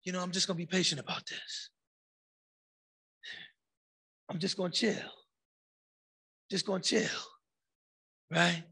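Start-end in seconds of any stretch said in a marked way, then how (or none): 0.0-7.2 quick, softer, fake-patient shrug; mock calm. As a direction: brush it off as if totally chill. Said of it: "And then you say".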